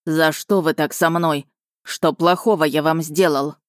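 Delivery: plain reading voice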